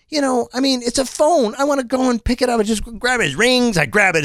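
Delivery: high-pitched frustrated rant; worked-up complaining. Real, not acted. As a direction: plead your case in exasperation, pitched high. Upset rant about the edge screen.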